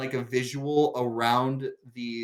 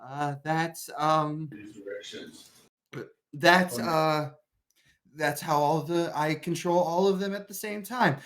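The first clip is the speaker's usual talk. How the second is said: hesitant, halting explanation with a touch of nerves; mildly flustered in-character explaining; shaky voice; 4 dB quieter than usual. Acted. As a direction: stammer through a nervous explanation. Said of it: "voice is so shaky".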